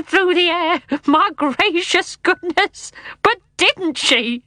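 high, drawn-out, tickled uncle's voice; mirthful uncle. A narrator's reading. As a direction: pitch it up and stretch the words with glee. Uncle helpless with mirth in story.

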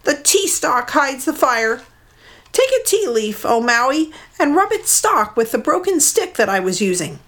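a small bird tricking her captor; mud hen. Acted. Captive mud hen fooling Maui, high bird voice.

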